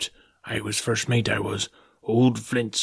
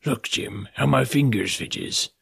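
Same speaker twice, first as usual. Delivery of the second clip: ordinary delivery, nothing marked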